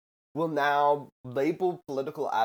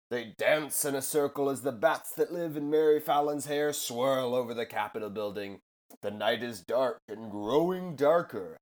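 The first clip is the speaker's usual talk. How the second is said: spooky storytelling; 3 semitones higher than usual. Acted. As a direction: read it like a dark witch's tale. Spooky witch-tale parody read.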